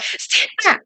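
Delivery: high, breathless, desperate cry; desperate stand back. Retold or imitated. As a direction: plead it like you're cornered. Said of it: "It sounds so desperate".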